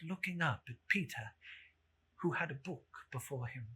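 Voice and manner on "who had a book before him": ordinary delivery, nothing marked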